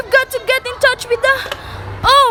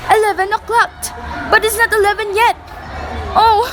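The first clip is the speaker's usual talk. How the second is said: ordinary talk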